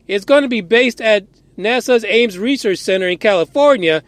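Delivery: usual speaking voice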